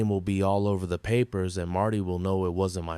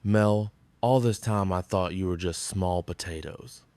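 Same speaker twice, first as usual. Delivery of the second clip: ordinary talk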